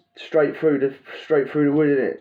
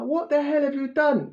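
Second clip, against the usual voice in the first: incredulous; 14 semitones higher than usual. Retold or imitated. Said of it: "It's like".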